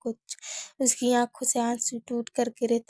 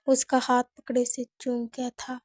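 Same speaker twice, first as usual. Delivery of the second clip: ordinary delivery, nothing marked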